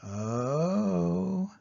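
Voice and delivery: ordinary delivery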